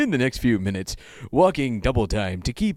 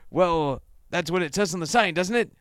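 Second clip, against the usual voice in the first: lightly voiced higher girl voice; giggly girl; 6 semitones higher than usual. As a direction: answer in a raised, girlish pitch. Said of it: "She giggles".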